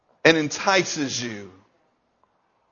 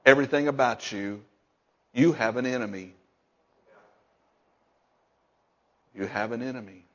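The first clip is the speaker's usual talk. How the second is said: hushed warning; 8 semitones lower, 8 dB quieter than usual. Acted. Hushed warning list continues.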